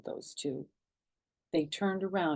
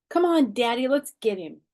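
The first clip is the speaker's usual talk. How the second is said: high, loud eager pull; excited urging; 7 semitones higher, 8 dB louder than usual. Acted. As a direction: hurry him along, eager. Child urging father.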